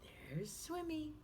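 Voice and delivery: usual speaking voice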